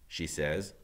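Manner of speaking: ordinary delivery, nothing marked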